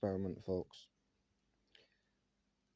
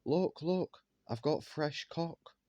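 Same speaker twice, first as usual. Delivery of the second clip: mimicking her boast; 6 semitones higher, slower than usual. Mocking his ex crowing to her friends, pitched high and slow. Quoting her showing him off.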